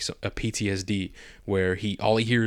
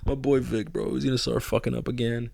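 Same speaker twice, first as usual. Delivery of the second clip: mock dismayed fan; 2 semitones higher, 4 dB quieter than usual. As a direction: groan it out like a fan watching his hero slip. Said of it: "I'm like".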